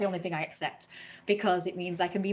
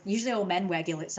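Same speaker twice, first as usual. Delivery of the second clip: ordinary talk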